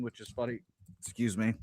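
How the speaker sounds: ordinary talk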